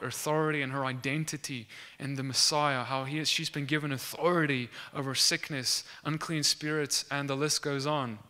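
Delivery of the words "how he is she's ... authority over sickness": normal talking voice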